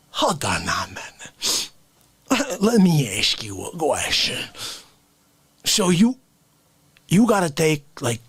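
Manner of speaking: ordinary talk, nothing marked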